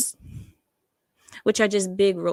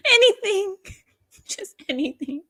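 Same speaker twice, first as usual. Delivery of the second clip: squealed high; 12 semitones higher than usual. Acted. Disgusted list climax.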